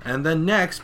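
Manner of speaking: ordinary talk, nothing marked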